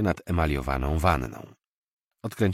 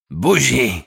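higher, loud leering roar; 8 semitones higher, 10 dB louder than usual. Acted. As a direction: bellow the demand at her. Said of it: "Zaryczał". (ryknięcie, głośno i wyżej)

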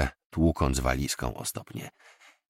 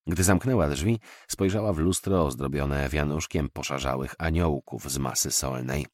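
usual speaking voice

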